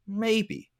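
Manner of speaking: a sly, high, drawn-out maybe; noncommittal maybe. Acted. The old farmer answering his friends, a knowing high maybe. Farmer's calm reply in proverb.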